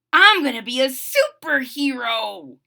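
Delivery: loud, gleeful announcement; happily. A narrator's reading. Fuji announcing a Halloween costume idea, happily. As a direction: declare it proudly with happy excitement. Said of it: "Fuji said happily".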